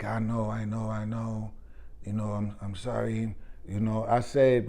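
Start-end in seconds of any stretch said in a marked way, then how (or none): 0.0-3.4 much softer, lower apologetic mumble; a hint of a guilty agent, voice dropped; mild sheepish agent. Agent caught out, apologizing to the speaker, softly sheepish.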